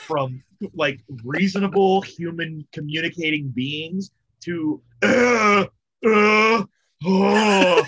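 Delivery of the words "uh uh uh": hammed-up wasted groaning; mock drunken grunts. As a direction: imitate incoherent drunks with raised, stretched-out groans. Imitates drunken incoherent state.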